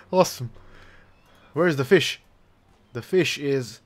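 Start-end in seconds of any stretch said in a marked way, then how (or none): none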